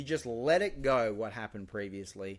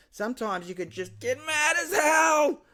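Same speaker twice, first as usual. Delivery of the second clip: much higher and louder; a loud, high rallying cry; passionate shout; 14 semitones higher, 8 dB louder than usual. Real, not acted. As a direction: shout it out with fervour. Ranting about anger.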